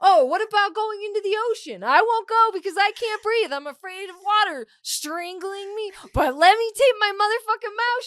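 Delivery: high, loud whiny mimicry; mocking friend. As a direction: parody her fussy fears. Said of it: "mocking friend's fears".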